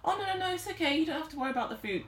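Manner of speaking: high, airy brush-off; casually nonchalant. Retold or imitated. Feminine casually telling divine masculine not to worry, high nonchalant voice. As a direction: wave it away lightly in a high voice. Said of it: "just so casually nonchalant".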